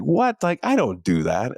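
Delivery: high, incredulous "What?"; retold indignant denial; mock surprise. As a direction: act out being taken aback. Retells own surprised denial to daughter.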